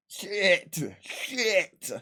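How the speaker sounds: high-pitched alarmed cursing under control; retold oh-no moment; mock panic. Retold panic at being refused.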